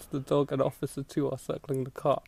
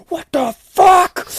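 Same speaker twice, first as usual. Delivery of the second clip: high, loud, slow disbelief; a drawn-out shocked cry; stunned outburst; 14 dB louder, slower than usual. Retold or imitated. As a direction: blurt it out stunned. Punk sees the K9 unit.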